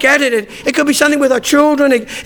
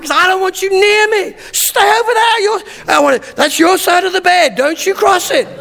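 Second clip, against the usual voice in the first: half-mocking shout; 6 semitones higher than usual. Retold fight with wife, cancer.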